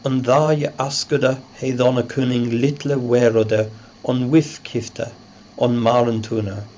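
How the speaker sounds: put-on reading the Old English text aloud. Shown as a demonstration. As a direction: recite it in Old English pronunciation. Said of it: "The text".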